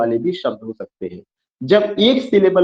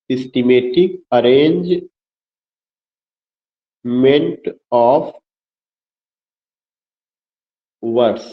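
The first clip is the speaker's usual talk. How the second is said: lower, slow words with long pauses; slow dictation; 4 semitones lower, much slower than usual. Real, not acted. Teacher dictating the definition to students, slow dictation. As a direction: dictate it one piece at a time.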